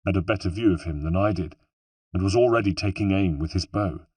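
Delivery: plain reading voice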